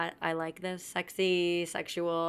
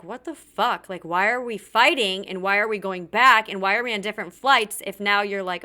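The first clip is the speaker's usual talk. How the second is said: loud, raised-pitch bewildered protest; exasperated confusion; 3 semitones higher, 8 dB louder than usual. Retold or imitated. Crying girlfriend at the airport baffled by the fight, exasperated. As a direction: hit each question harder, incredulous and frustrated.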